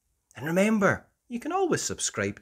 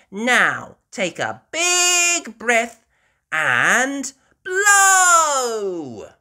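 high, slow, loud coaxing voice; Peppa coaching; 12 semitones higher, 9 dB louder, much slower than usual. Acted. Peppa urging George to blow, high and drawn out. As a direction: stretch it out to coax him. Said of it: "Peppa tells George".

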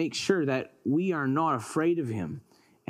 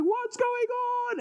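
very high, stretched-out panic; alarmed cry; slower than usual. Acted. Elijah in the shaking cave, alarmed high cry.

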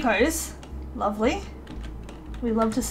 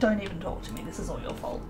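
much lower, muttered sulky wife; grumpy wife voice; 7 semitones lower, 11 dB quieter, faster than usual. Retold or imitated. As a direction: grumble it low like a sulking wife in labor. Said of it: "she's like".

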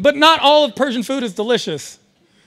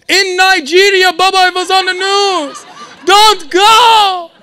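higher, louder pleading dad; imitating dad; 6 semitones higher, 7 dB louder, slower than usual. Scene: father begging his son to stay home, pleading. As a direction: beg him not to go, panicked. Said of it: "dad begs".

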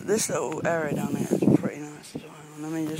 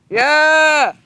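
distant shout; 10 semitones higher, 16 dB louder than usual. Real, not acted. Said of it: "someone just, like, shouting randomly".

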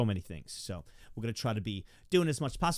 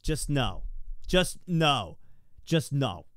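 loud refusal; 3 semitones higher, 8 dB louder, much slower than usual. Real, not acted. Refusing the Susie voice.

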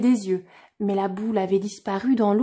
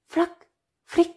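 plain reading voice

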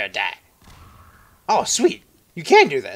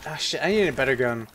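ordinary talk

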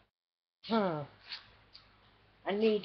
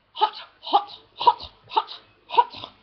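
voiceless puffed-out words; overheated panting; 6 semitones higher, slower than usual. Real, not acted. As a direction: puff each word out, hot and winded. Overheated after wild laughing.

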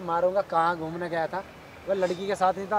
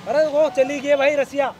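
exasperated shout; 7 semitones higher, 5 dB louder, slower than usual. Acted. Boyfriend denies cheating, heated quarrel.

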